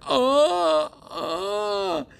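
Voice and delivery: high startled oh; shocked gasp. Pretend. Storyteller reacting to the hammer attack, high startled oh. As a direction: gasp as if the fingers are in danger.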